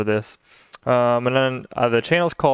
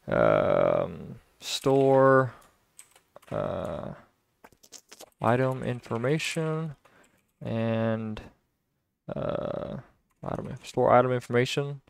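slow, soft, broken phrasing with long pauses; hesitant, quiet self-talk; thinking aloud; 6 dB quieter, much slower than usual. Real, not acted. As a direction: work out the wording half to yourself. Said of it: "I'm trying to think through this".

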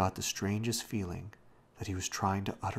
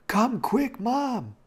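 high, loud, urgent summons; frightened shout; 9 dB louder, much slower than usual. A narrator's reading. Robbie fearing for panting old Da, shouting for Mom. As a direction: yell for help, pitch right up. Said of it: "shouting, for he was in real fear now".

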